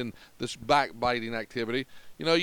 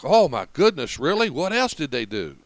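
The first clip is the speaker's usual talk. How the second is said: louder, hammed-up gossip-hungry surprise; mock eager astonishment; 6 dB louder than usual. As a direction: play the scandalized listener fishing for more. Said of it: "entice the person to tell us more".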